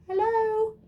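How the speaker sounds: very high little voice; tiny voice. Acted. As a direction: squeak it out small and high. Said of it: "came a tiny voice".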